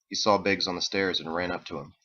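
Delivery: ordinary delivery, nothing marked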